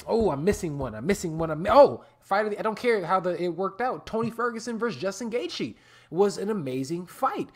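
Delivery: ordinary talk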